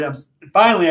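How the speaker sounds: normal talking voice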